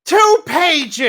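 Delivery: high, loud disbelief; high-pitched, indignant surprise; incredulous outburst. Real, not acted. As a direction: cry it out in shocked dismay. Startled by how little was read.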